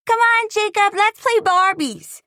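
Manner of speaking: put-on very high, loud girl's invitation; eager little girl. A narrator's reading. Small girl inviting the boy to play Barbies, very high and eager. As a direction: coax a playmate like a small girl.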